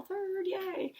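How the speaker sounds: high cheerful cheer; excited yay. Real, not acted. Author cheering her book's release, excited. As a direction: squeal it with delight.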